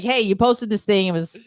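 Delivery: ordinary delivery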